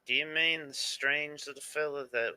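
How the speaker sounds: guard in a light Southern drawl; Southern accent. Acted. Guard asking about the mushroom man, light Southern accent. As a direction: slip into a lazy Southern accent. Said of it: "every accent I do tonight is just slipping slowly into Southern".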